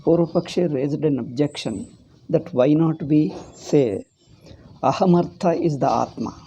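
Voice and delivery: ordinary talk, nothing marked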